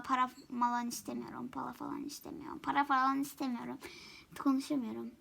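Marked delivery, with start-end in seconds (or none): 1.5-2.6 whisper. Shown as a demonstration. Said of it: "repeats line in different ways".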